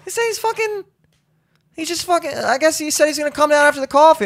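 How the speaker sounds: very high, strained retelling; flustered report. Retold or imitated. Telling family he's at coffee, flustered. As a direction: squeak out the bad news.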